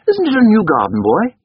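put-on high, loud questioning boy's voice; young master. A narrator's reading. Boy questioning the coachman in the garden, high childish query. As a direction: ask as a small boy, pitched high. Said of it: "Then, altering back".

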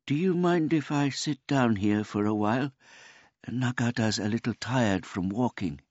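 put-on high, polite old man voice; a gentle, childlike old man; simple old man. A narrator's reading.

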